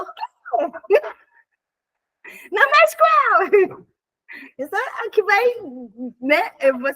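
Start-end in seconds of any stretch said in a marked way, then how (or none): 0.0-3.9 mock snap. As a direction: bark back like a little angry pinscher. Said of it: "vai falar". (bronca fingida, aguda e alta)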